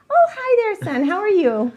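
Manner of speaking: high-pitched hostess hello; friendly mom greeting. Acted. Voices mother greeting a boy.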